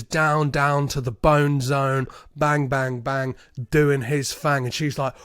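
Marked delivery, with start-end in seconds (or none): none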